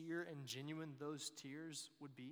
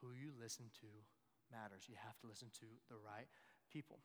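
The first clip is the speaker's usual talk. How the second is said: ordinary talk, nothing marked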